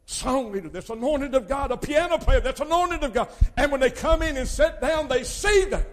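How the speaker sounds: rising loud, high preaching climax; preacher building to a holler. Acted.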